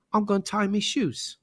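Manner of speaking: lightly hammed-up British accent; playful fake English accent; bad British accent. Shown as a demonstration. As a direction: demonstrate a mock-British accent. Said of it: "if you wanna sound British".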